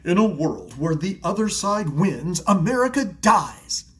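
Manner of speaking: slow, dramatic trailer narrator; movie trailer voice. Retold or imitated. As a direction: build to a loud doom on dies. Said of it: "sounds like a trailer for an apocalypse movie".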